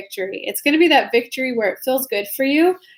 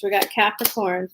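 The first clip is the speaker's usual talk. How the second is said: normal talking voice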